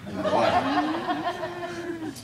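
high, slow line squeezed through laughter; laughing joke. Real, not acted. Joking aside, laughing at himself.

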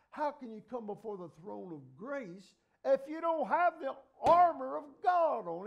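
high, loud preacher's cry building to a slow peak; fiery revival preaching, drawn out at the end; preaching at full force. Acted. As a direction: drive it home to the congregation.

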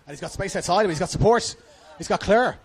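loud commentary climbing to a high peak; building excitement. Real, not acted. Commentator following a winger's run towards goal, building excitement. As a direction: call the attack with mounting urgency.

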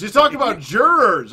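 loud, high excited call; excited shout. Real, not acted. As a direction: shout it out gleefully. Calling out mishearing.

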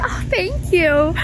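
high, slow, squeaky thanks; bashful thank you. Retold or imitated. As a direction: gush it back, flattered. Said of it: "I'm like".

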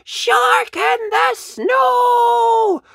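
very high, loud roaring shout. A narrator's reading. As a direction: roar it out loud and clear. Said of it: "he's roaring out loud and clear".

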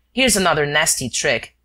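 ordinary delivery, nothing marked

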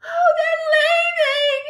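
high, drawn-out wail; distraught girl, out of breath; breathless cry. A narrator's reading. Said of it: "she cried".

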